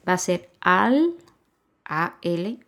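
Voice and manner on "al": AL said alone as one syllable. Shown as a demonstration. Teacher splitting aldea for learners, syllable by syllable AL. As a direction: AL held apart, then a pause. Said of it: "Si yo separo la palabra aldea en sílabas".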